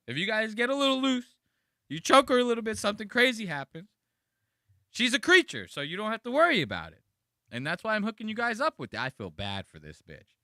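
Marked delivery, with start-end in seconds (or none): 0.1-9.0 hammed-up loud host. Mocking introducer escalates.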